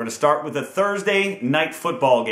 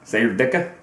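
mock Chicago accent; 6 semitones lower, faster than usual. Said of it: "this horrible Chicago accent".

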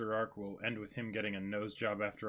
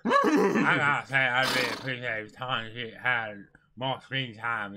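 loud; 9 dB louder than usual. Pretend. Loud mock praise.

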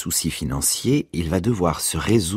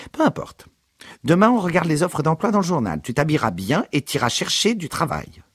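mother listing son's spending, higher; exasperated mother; 11 semitones higher than usual. A narrator's reading. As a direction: tick off his purchases indignantly.

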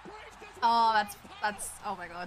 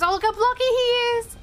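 delighted; 11 semitones higher, 6 dB louder, much faster than usual. Real, not acted. Delighted at blocky character.

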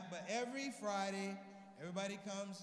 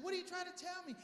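mom's voice pitched way up, puzzled; high mom voice; 10 semitones higher, 4 dB quieter than usual. Retold or imitated. Mother pushing her son, high and puzzled. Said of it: "She said".

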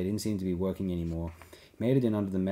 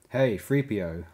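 louder hail to a companion; calling out; 2 semitones higher, 7 dB louder, slower than usual. A narrator's reading. Lando waving the droid over, calling out. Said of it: "Lando called".

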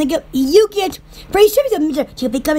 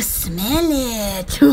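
radio announcer; 8 semitones lower, 6 dB quieter than usual. Pretend. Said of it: "I sound like a radio announcer".

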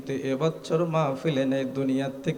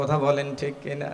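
ordinary talk

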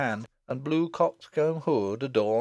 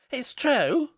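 put-on very high, louder little girl; insisting child; 14 semitones higher, 5 dB louder than usual. Little girl insisting on her gossip, high loud voice.

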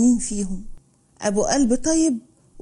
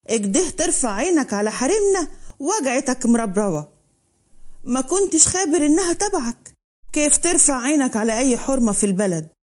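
usual speaking voice

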